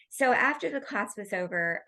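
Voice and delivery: usual speaking voice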